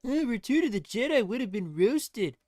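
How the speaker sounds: high, loud mocking whine; whiny writer. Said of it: "writers weren't such whiny".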